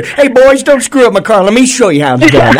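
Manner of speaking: light, teasing put-on quote; playful mock quote. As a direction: playfully imitate a driver telling the crew off. Host playfully voices the driver's words.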